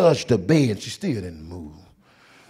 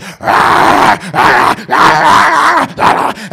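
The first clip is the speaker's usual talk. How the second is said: loud raspy shrieking ahs; possessed patient screaming; 11 semitones higher, 13 dB louder, much slower than usual. Retold or imitated. Demon-possessed patient screaming at the usher. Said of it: "and she just".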